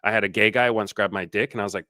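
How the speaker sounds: ordinary talk, nothing marked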